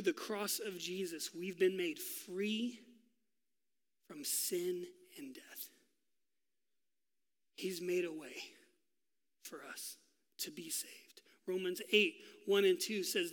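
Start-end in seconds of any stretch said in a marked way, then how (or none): 4.1-5.7 hushed, slow whisper; preacher whispering the promise, slow and soft. Real, not acted. Preacher moved, softening. 7.6-11.2 soft whispered ending; preacher whispering, much softer. Real, not acted. Preacher hushed closing phrase.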